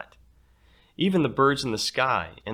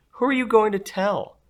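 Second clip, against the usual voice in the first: put-on taunting girl; 12 semitones higher, 8 dB louder than usual. Cassidy taunts.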